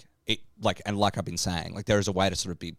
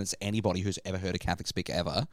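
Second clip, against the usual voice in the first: usual speaking voice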